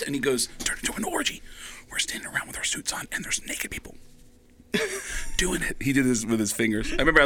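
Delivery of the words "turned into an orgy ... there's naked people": hushed, scandalized whisper; super nervous. Retold or imitated. Agent confiding party gossip to comic, nervous whisper. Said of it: "super nervous".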